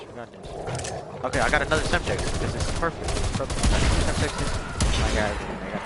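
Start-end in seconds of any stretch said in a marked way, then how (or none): 0.0-1.2 slow whisper to myself; whispered pep talk. Real, not acted. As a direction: breathe it out slowly, just for me. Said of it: "So I'm telling myself".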